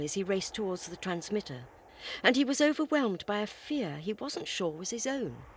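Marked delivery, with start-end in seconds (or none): none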